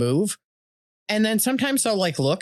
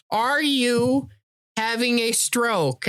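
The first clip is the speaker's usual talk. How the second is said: slow, higher, spaced-out question; baffled question; 6 semitones higher, much slower than usual. Retold or imitated. Friend reacting to garbled texts, slow baffled question. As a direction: stretch each word, pitched up. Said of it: "you are like".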